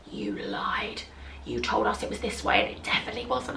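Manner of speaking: hushed, unvoiced "you lied"; whispered accusation. Girls accusing her of lying, whispered. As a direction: hiss the accusation under your breath.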